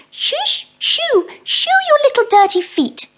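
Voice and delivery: high, loud, fussy little mouse shooing a beetle; a tidy mouse scolding and shooing. Acted. Mrs Tittlemouse shoos the beetle from her floor, high fussy mouse voice.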